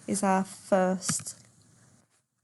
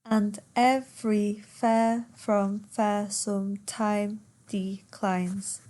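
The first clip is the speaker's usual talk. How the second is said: slow, syllable-by-syllable verse reading; per suku kata; slower than usual. Shown as a demonstration. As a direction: count out the line, one beat per syllable. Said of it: "Ten syllables once again".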